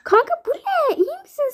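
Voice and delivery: shocked. Acted. Disgusted friend reacting to baby food. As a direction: recoil at the taste.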